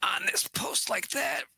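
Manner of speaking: high whispered menace, rising steeply; hammed-up creepy killer, whispered then squeaky; mock killer voice. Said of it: "And Michael's, you know, like".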